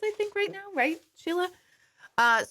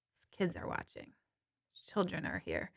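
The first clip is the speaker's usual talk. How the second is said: hushed, sheepish whisper; 11 dB quieter than usual. Real, not acted. Apologising to Facebook viewers after cursing, whispered. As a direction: drop to a guilty whisper after swearing.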